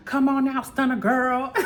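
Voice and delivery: mock-call her out, amused; playful imitation of a fighter calling someone out; imitated taunting call. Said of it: "so funny how she said that".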